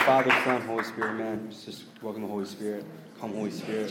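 hushed, reverent invocation; quiet prayer, barely projected. Real, not acted. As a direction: softly invite the Spirit in prayer. Opens in prayer.

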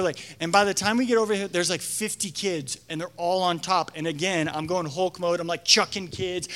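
ordinary delivery

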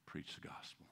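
voiceless, intimate whisper. Real, not acted. Pastor confessing his calling to the congregation, whispered. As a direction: let it fall to a whisper.

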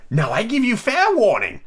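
high, slow, imperious Queen; Queen's shout. A narrator's reading. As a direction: issue a high-pitched royal warning. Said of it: "shouted the Queen".

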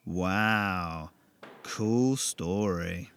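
deflate him with a smirk; mock-impressed mate; smirking sarcasm.

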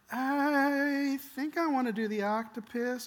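put-on high, slow, reluctant kid; timid drawn-out kid voice; hesitant child. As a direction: stretch it out like a child losing nerve.

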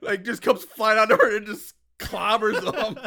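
loud, high, breaking into laughter; laughing. Real, not acted. Co-host telling the hilarious flying pipe gag, laughing. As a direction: crack up while telling it.

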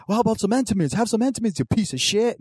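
high, fast mocking wrestler taunt; Booker T impression. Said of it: "he's like".

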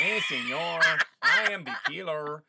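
loud, high cartoon Mexican bandit accent; hammed-up bandito with a thick fake Spanish accent; exaggerated, shouted Mexican accent parody. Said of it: "a terrible Schwarzenegger and a terrible Stallone".

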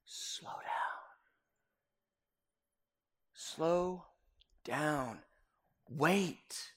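hushed whisper, slow and spaced; soft unhurried whisper of God. Retold or imitated. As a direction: breathe it softly like a still small voice. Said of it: "And God goes".

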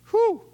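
high loud exhaled whew. Real, not acted. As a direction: blow out a shocked whew. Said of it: "reacting to self-cutting".

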